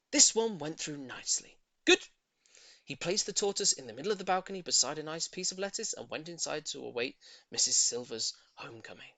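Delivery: ordinary delivery, nothing marked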